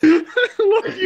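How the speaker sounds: laughing. Real, not acted. Laughing farewell.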